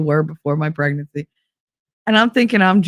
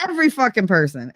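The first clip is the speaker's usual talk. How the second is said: normal talking voice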